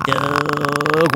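slow, drawn-out monster growl; scary monster voice. Acted. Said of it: "Our scariest monster voice away from the mics".

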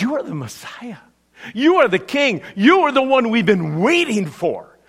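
loud, eager declaration, pitched high; eager shout. Retold or imitated. Peter to Jesus, blurting the answer, eager loud shout. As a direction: call it out fast like you finally know the answer. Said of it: "shouts out".

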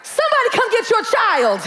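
put-on slow, drawn-out whose child is this; exasperated mom. Mother fed up with her daughter, drawn-out exasperated disowning. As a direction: disown the kid with mock exasperation. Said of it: "I'm like".

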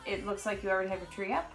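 usual speaking voice

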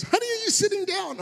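high-pitched imitation of a panicked wife; high, urgent mock alarm. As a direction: mimic your wife calling in a panic. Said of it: "she rings me in a panic".

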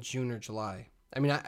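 usual speaking voice

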